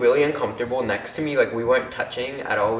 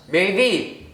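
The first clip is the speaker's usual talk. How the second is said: higher-pitched mock call-out; retold shout; 8 semitones higher, 3 dB louder than usual. Friend spotting someone in the caf, mock shout. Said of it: "looks up and shouts".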